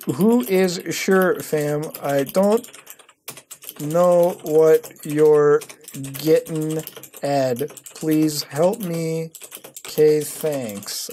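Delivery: very slow, drawn-out words; typing aloud. Real, not acted. Typing a question to the commenter, slowly aloud. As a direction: dictate it word by word as you type.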